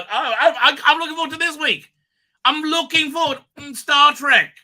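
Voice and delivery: loud, high and fast with a laugh; excited. Real, not acted. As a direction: burst out with eager joy. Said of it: "Me, I'm excited".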